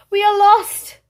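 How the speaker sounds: high, loud panicked wail; despairing cry. Acted. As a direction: cry out that all is lost. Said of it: "said the people".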